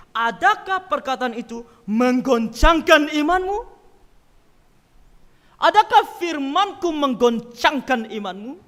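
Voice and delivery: slow, weighty question; slow preaching voice; solemn quote. Acted. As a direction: let each word land slowly. Quoting Jesus solemnly.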